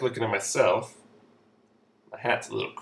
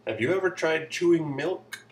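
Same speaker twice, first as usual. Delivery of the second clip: ordinary talk, nothing marked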